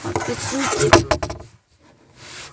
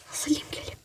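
whispering; 10 dB quieter than usual. Real, not acted. Whispered under strain.

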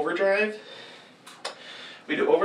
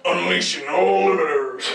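robot announcer voice; 4 dB louder than usual. Acted. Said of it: "This one just goes like".

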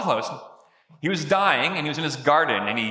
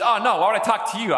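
cheeky; 5 semitones higher, faster than usual. Retold or imitated. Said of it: "He says".

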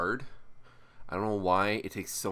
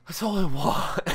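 louder, higher cry of frustration; exasperated outburst; 12 semitones higher, 10 dB louder than usual. Real, not acted. Tired vlogger venting to viewers, loud high outburst. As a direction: throw up your hands, higher and louder.

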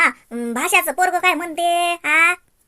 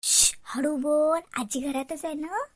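hushed warning; 7 dB quieter, slower than usual. Acted. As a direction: hush the child so granny won't hear. Hushing child, granny is home. (दबक्या आवाजात गप्प करणे)

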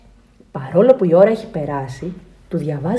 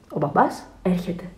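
ordinary delivery, nothing marked

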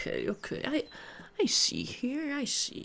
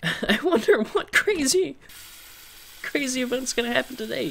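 mock excited; 7 semitones higher, 4 dB louder than usual.

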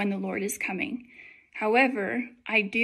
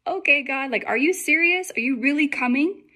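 higher-pitched retold disbelief aimed at God; incredulous questioning; 4 semitones higher, 4 dB louder than usual. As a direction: ask God as if you can hardly believe it. Retells her incredulous question to God.

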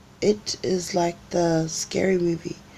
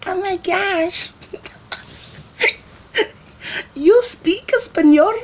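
much higher, amazed and slow; mock amazed people; 5 dB louder, slower than usual. Strangers amazed she speaks Spanish, high mock squeal. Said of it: "And then they're like".

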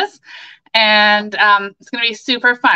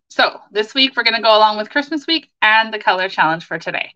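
usual speaking voice

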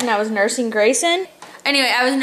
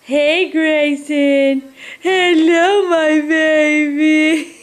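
put-on higher, slow, louder baby-voice greeting; baby talk greeting; 4 semitones higher, 3 dB louder, much slower than usual. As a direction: coo it like a happy baby meeting mom. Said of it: "He was like".

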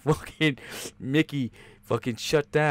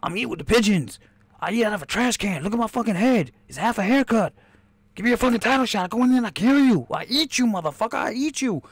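Clubber Lang impression; 10 semitones higher than usual. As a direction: squeal it out as the hungry fighter. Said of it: "He was like".

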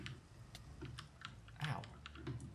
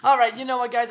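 loud wrap-up; 9 semitones higher, 12 dB louder than usual. Real, not acted. Wrapping up the episode.

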